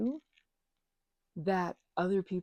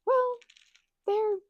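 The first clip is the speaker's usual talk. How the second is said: exasperated; 8 dB louder than usual. Real, not acted. Exasperated pitch jump.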